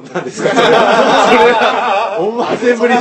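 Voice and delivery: talking through a laugh; laughing hard while teasing. Real, not acted. Teasing the guest's coy answer.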